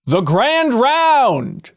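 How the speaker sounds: slow, high, drawn-out game show announcer. Acted. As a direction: stretch it out like a game show host. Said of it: "Still got it".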